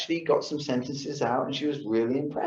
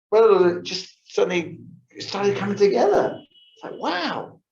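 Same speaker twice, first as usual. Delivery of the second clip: excited realization; 8 semitones higher, 4 dB louder than usual. Real, not acted. Piece suddenly comes together.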